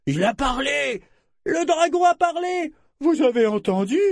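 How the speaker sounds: very high cries of amazement; stupefied exclamations. A narrator's reading. Onlookers on the quay hear a dragon speak, stupefied. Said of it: "Certains poussaient des exclamations stupéfaites".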